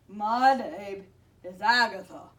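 loud, stuffed-up monster introduction; sniffling monster. A narrator's reading. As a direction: speak up as a snotty monster. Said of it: "sniffled through noses".

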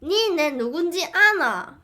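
put-on cocky Gyeongsang accent; 경상도 사투리 허세; Gyeongsang dialect. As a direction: act tough in a Gyeongsang accent. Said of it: "연변 사투리 같다고요?".

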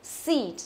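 "Seat" with spread lips and long ee; careful long-i "Seat"; tense long vowel. Shown as a demonstration. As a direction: widen the mouth and hold "Seat", falling. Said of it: "Đọc căng miệng ra một tí".

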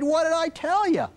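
acted parent fed up with a repeat offence; annoyed parent. Parent finding feet on the wall again, annoyed. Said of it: "Most parents become increasingly annoyed".

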